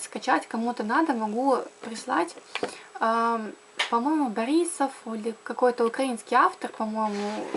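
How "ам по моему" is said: ordinary talk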